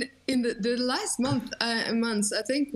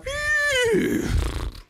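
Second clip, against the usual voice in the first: loud high whinny, drawn out; horse neigh imitation; 4 dB louder than usual. Trying his old horse neigh again on the podcast. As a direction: give your best horse neigh. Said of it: "if I can still do mine".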